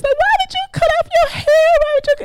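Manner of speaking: very high, fast, wailing grandmother imitation; grandmother crying. Grandmother crying over her granddaughter's shaved hair, high wail. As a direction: cry it out like a heartbroken grandmother. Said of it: "She said".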